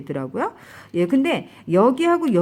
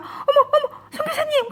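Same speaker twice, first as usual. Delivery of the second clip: very high, urgent cry of a name; retold cry to the stumbling missionary, very high; alarmed calling out. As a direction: call out to her in alarm. Calling out to stricken friend.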